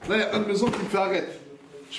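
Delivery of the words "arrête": normal talking voice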